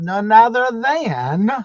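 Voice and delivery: slow, drawn-out ring announcer build-up; wrestling announcer. Pretend. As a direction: introduce her like a champion entering the ring. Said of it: "I think of that announcer".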